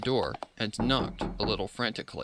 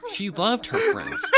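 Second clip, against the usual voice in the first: laughing while reading; 12 semitones higher, 4 dB louder, slower than usual. Real, not acted. Narrator cracks up reading.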